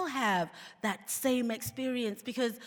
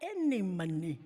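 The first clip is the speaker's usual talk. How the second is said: wry aside; 5 semitones higher, 4 dB quieter than usual. Retold or imitated. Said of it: "I'm like".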